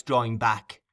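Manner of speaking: ordinary delivery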